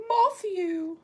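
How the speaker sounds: put-on high, drawn-out child voice; little boy impression. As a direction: mimic the little boy slowly. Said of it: "that little boy who's giggling".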